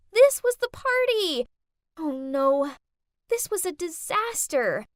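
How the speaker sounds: the penny drops in horror; horrified realization. A narrator's reading. Narrator realizing her mom serves here, horrified.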